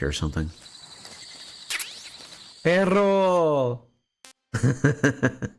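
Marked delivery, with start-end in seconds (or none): 2.6-3.8 high, drawn-out "Perro" with a long trilled R; rolled R. Pretend. Player calling out at a dog, showy rolled-R "Perro". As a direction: call out "Perro", rolling the double R hard. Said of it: "such a good R roll".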